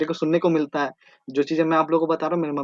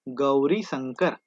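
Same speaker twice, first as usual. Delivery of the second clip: slow drill; 4 semitones lower, much slower than usual. Shown as a demonstration. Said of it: "teacher drilling the mountain name".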